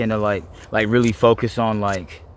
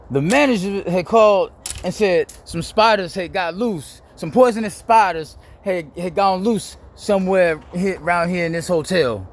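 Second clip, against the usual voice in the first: higher, slow mock-serious warning; retelling a spider prank call, higher and slow; prank caller voice; 7 semitones higher than usual. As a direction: imitate the prank caller, drawn out and high. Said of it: "He said".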